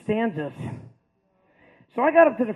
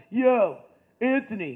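loud, punchy classmate's yell; calling out; 2 semitones higher, 6 dB louder, slower than usual. Retold or imitated. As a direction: call over to a buddy across class.

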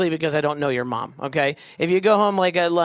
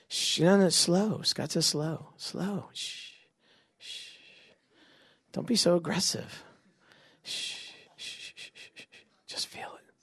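very soft, breath-level shushing; hushed soothing; 9 dB quieter, slower than usual. Pretend. Game leader slowing players' arm circles, hushed and soothing. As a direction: lull them with long shushes and near-whispers.

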